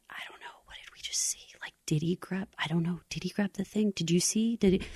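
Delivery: hushed, hesitant whispering; furtive, barely voiced questions; unsure whisper. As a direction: whisper doubtfully to the person next to you. Acting unsure witness conferring privately.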